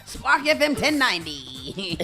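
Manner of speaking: ordinary talk, nothing marked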